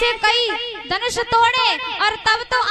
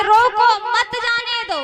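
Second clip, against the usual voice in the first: रोको set apart, मत joined to जाने दो; pause after रोको; 3 semitones higher than usual. Acted. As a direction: stop after "रोको", then run "मत जाने दो" together. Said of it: "उसने तो कहा था कि रोको".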